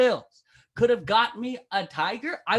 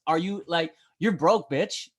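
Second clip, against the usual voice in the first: ordinary delivery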